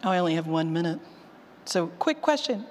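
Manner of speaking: ordinary talk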